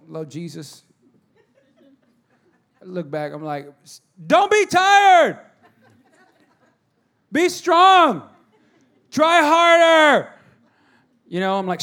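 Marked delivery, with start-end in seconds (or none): 3.8-10.3 slow, higher, loud drill-sergeant shouting; mock yelling dad. Dad shouting at his tired son to try harder. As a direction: bark it out slowly like a pushy coach. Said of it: "screaming at him".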